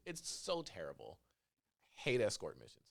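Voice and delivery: ordinary talk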